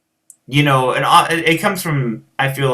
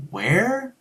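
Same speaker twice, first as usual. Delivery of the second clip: high, soft, rising "Where?"; mock bewilderment, quiet and high; puzzled question; 8 semitones higher, 5 dB quieter than usual. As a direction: imitate a baffled local asking, pitch shooting up. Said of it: "they're like".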